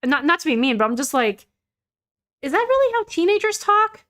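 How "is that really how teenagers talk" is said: high-pitched disbelieving question; pitched-up incredulous query. Acted. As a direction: ask it high, in mock bafflement. Incredulous question about stilted dialogue.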